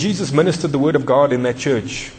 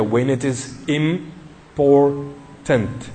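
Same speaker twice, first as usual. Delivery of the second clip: IMPORTANT broken into slow, stretched syllables; drawn-out emphasis; much slower than usual. Real, not acted. Preacher answering his own question to the congregation, IMPORTANT slowly drawn out. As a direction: spell out the weight of IMPORTANT, very slowly.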